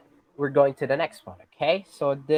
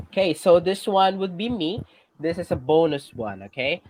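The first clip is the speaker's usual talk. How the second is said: ordinary talk, nothing marked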